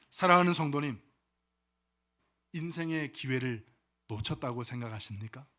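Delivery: normal talking voice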